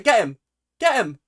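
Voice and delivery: loud, high cheering on; urging shout. Real, not acted. Cheering the chase in the video, loud and high. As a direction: egg them on loudly.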